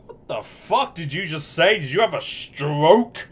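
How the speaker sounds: high, loud outraged chef; an appalled chef recoiling at the order; baffled chef. Retold or imitated. Chef reacting to a strange order, loud outrage. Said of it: "Chef looks at you like".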